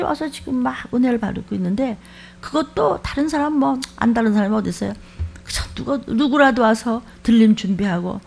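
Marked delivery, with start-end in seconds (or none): none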